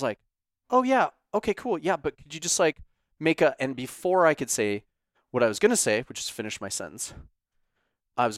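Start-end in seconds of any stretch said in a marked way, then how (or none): 0.7-3.5 high polite. Retold or imitated. Polite request, pitch jumps high.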